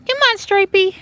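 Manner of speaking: loud, very high pet call. Real, not acted. Owner calls Stripey to walk with her, high loud pet call.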